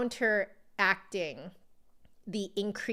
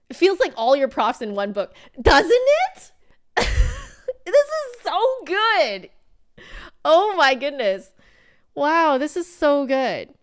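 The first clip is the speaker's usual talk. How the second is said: high, loud delighted squeals with a laugh; thrilled gushing; 8 semitones higher, 10 dB louder than usual. Real, not acted. Flute teacher gushing to viewers over a great book, squealing high and loud.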